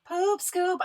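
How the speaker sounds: sing-song the segment name Poop scoop up high; segment title announced. Shown as a demonstration.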